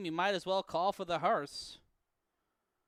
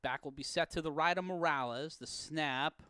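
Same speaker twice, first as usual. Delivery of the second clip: ordinary talk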